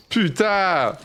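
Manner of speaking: high, drawn-out frustrated curse; exclaimed gamer curse; frustrated swearing. Real, not acted. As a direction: cry out the swearword in exasperation. Cursing after a game mishap.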